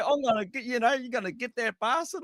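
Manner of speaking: high-pitched put-on English accent; bad English accent. Host imitating young driver chasing a rival, bad English accent. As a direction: mimic an English racer, pitched well up. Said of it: "I don't think I've ever sounded like that".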